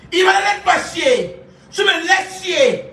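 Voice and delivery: high, laughing shout, slowing at the end; mock yell. Ordering Ibana to stop the curse, laughing shout. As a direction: yell at her half laughing.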